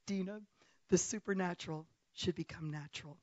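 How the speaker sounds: soft, measured voice; lightly voiced God. Retelling what she heard God say, lightly voiced God. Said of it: "I heard God say".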